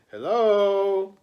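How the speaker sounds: a caller peering in at the doorway, calling hello; called hello. Acted. A stranger calls into the house, lightly voiced. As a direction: call hello into the empty doorway.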